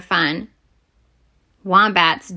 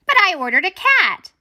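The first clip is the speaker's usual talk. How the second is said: dismayed protest; 9 semitones higher than usual. Acted. Girl protests getting a wombat.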